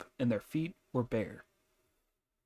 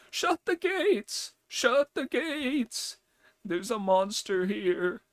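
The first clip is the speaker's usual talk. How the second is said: put-on much higher, slow, panicked yell of a frightened old man; terrified shouting; 12 semitones higher, 3 dB louder, slower than usual. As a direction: scream for the gates to be shut in terror. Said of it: "he ran into the house shouting".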